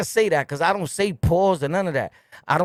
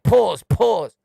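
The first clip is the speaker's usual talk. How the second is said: loud, higher mimicked catchphrase; mock interjection; 4 semitones higher, 6 dB louder than usual. Ridiculing people who talk like that, mock interjection. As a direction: parody someone butting in loudly. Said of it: "If you talk like".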